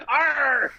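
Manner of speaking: a quick hammed-up pirate shout through laughter; pirate arr. Mock pirate arr while joking about Pirates of the Caribbean. Pirate growl after Depp bit.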